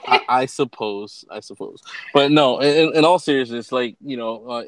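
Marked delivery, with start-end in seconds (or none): 1.3-1.8 muttered aside. Real, not acted. Host mumbling a resigned aside to co-host, quietly. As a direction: drop to a low mumble under the breath.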